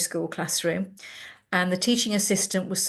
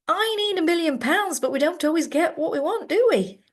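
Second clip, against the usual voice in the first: much higher sing-song teasing; cheeky mock wisdom; 10 semitones higher, 3 dB louder than usual. Boy mocking his teacher's words back, sing-song. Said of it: "John laughed and he said".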